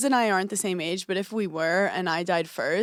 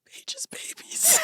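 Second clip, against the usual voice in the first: high breathy whispered sob of a friend; 5 dB quieter, much slower than usual. Retold or imitated. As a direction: whisper it high and tearful, mock crying.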